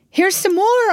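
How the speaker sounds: high, loud, eager call; eager shout to the doctor; excited cry. A narrator's reading. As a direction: call out the news. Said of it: "I cried".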